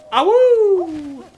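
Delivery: high loud playful wolf howl. Acted. Flea rescued celebration.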